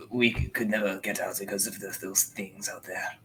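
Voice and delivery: a hushed, weary prisoner, often dropping to a whisper; trapped prisoner. Acted. Trapped man pleads through the wall to strangers, hushed and weary.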